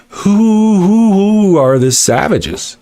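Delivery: high, slow accusing question; rhetorical challenge. Real, not acted. To the chatter, turning the savages charge back, slow and pointed. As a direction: drag it out to shame them.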